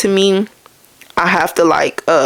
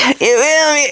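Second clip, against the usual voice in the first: laughing; 8 semitones higher, slower than usual. Real, not acted. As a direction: laugh through the question. Laughing at own story.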